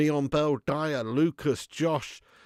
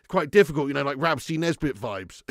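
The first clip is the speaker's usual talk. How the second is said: usual speaking voice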